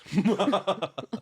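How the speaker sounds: high, witchy burst of laughter; wicked witchy laugh; witchy cackle. Real, not acted. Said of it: "That was very witchy".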